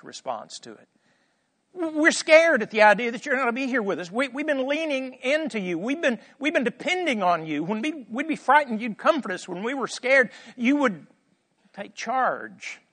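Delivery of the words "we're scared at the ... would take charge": high-pitched anxious pleading; worried disciples. Acted. Disciples afraid of losing Jesus' protection, anxious pleading. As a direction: pour out the worry in a raised voice. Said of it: "They're worried about themselves".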